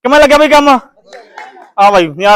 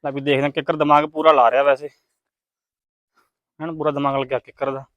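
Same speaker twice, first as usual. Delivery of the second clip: low, very soft aside; hushed commentary; 9 semitones lower, 9 dB quieter than usual. Real, not acted. Host to viewers while the player concentrates, hushed commentary. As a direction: murmur it so as not to disturb the player.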